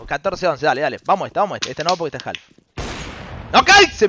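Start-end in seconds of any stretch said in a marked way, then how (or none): 3.5-4.1 sudden high, loud exclamation; excited outburst. Real, not acted. Streamer reacting to the player's move, excited outburst. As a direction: cry out at the play, high and loud.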